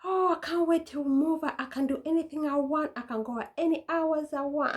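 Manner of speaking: put-on high, dreamy teenage longing; whiny kid voice. Kid bragging to mom about future freedom, high dreamy voice. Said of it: "you were like".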